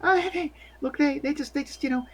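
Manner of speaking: high, halting, placating voice; nervous stammer. Acted. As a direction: fumble through excuses in a small high voice. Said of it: "she gets really nervous now".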